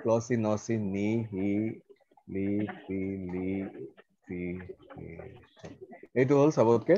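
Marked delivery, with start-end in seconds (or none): 0.0-5.1 softly, in separate syllables with pauses. Shown as a demonstration. Teacher typing the longest word, soft syllable-by-syllable. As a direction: spell out the word piece by piece, low and soft. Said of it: "count কৰা আৰু ক'বা যে কেইটা syllable".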